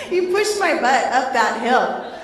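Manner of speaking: laughing through embarrassment, high and loud; embarrassed laughing story. Real, not acted. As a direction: giggle out the embarrassing part.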